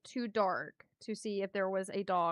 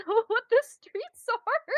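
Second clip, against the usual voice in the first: helpless laughter breaking into higher-pitched speech; laughing while talking; 8 semitones higher than usual. Real, not acted. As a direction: try to argue through a laughing fit. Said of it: "I'm laughing so hard right now".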